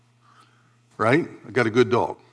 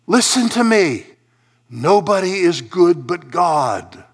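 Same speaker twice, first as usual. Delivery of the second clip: high, emphatic appeal slowing down; insist, then draw the words out; Jesus; 6 semitones higher, 5 dB louder, slower than usual. Acted. Jesus giving the ruler the option, urgent then slow. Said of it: "Jesus has even given him".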